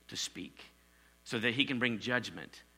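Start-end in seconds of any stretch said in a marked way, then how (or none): 0.1-0.7 a sudden whispered "to speak". Acted. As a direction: hush right down to a whisper. Preacher drops voice for emphasis.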